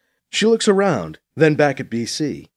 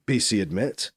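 ordinary delivery, nothing marked

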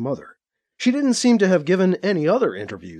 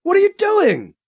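much higher, loud panicked shout; patient shouting at the doctors; 5 dB louder than usual. A narrator's reading. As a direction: yell at the surgeons. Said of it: "Alibi shouted".

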